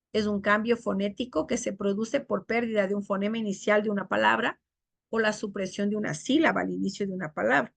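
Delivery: ordinary talk, nothing marked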